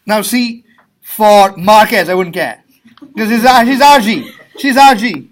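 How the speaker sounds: booming playful jab at a student, laughing; loud teasing. Real, not acted. Teacher teasing a student in front of class, loud and laughing.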